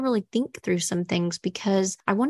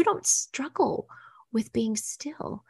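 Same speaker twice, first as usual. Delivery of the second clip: whisper; 4 semitones higher, 6 dB quieter, slower than usual. Real, not acted. Intimate aside to listeners.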